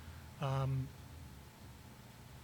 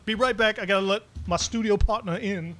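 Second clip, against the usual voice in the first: ordinary delivery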